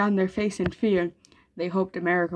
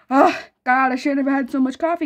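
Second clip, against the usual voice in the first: frantic; 5 semitones higher, 6 dB louder than usual. Retold or imitated. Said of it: "I'm over here".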